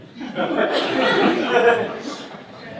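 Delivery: high, airless burst of laughter; helpless silent cackle; breathless laugh. Real, not acted. Speaker cracking up at his boss's joke, a breathless high laugh.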